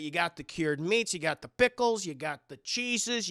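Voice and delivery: ordinary delivery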